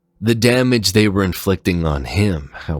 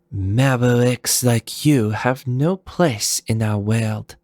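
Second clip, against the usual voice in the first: higher, slow and cold; cool female warrior; 7 semitones higher than usual. Acted. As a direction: say it icily, unhurried. Leviathan scorns Zero.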